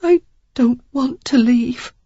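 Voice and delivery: high, slow, choked with tears; tearful woman refusing to leave, slowly; thickly. Acted. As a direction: fight back tears on each word. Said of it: "she said thickly".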